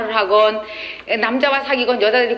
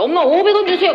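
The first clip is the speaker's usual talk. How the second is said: put-on high, louder childish plea for cash; whiny son; 8 semitones higher, 4 dB louder than usual. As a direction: beg like a clueless little boy. Son asks mom for money.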